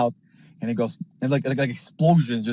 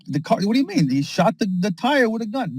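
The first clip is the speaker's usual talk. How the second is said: pitched way up, incredulous; high indignant protest; 7 semitones higher than usual. Acted. Cop actor protesting to Adam Aaron, high and incredulous.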